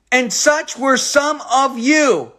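slow, weighty pulpit line; solemn scripture reading. Acted. Preacher slowly reading the verse to his listeners, solemn. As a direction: let each word land slowly.

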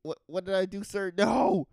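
stammering, high, loud denial; mock panicked protest. Comedian acts out accused driver answering the neighbor, mock panicked. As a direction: stumble into an exaggerated plea of innocence.

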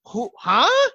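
high, loud stunned what; shocked disbelief. Retold or imitated. Retelling his shocked reaction to the surprise email, high and loud. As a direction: sputter in total disbelief. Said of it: "my response was".

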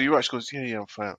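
ordinary talk

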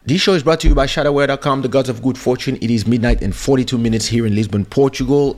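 quick, upbeat sponsor plug; ad read. Acted. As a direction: rattle off the sponsor line like a radio host. Sponsor read over music.

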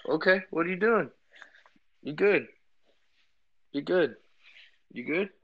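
dad checking on his upset daughter, tender. Real, not acted. Dad comforting scared little daughter.

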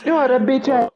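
very high squeaky cartoon voice; squeaky comic abuse; Mario talk. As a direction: squeal insults like a cartoon plumber. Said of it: "you gotta do the Mario talk".

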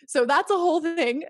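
amused shrug of a laugh; laughing wrap-up. Real, not acted. Wraps up with a laugh.

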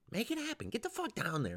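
high-pitched, insistent pep talk; urgent urging. Retold or imitated. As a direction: push a friend in a squeaky pitch. Urging repeated to another comic.

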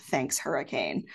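usual speaking voice

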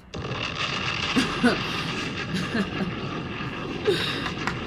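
airy laugh at the rival; breathy mocking laugh. Real, not acted. Laughter after jab.

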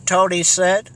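plain reading voice

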